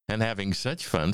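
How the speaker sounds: ordinary delivery, nothing marked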